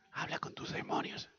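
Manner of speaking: barely voiced, very soft; whispered jab; hushed aside. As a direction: say it under the breath. Voices husband's needling, very hushed.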